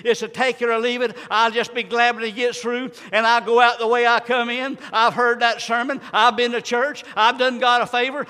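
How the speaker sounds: ordinary talk, nothing marked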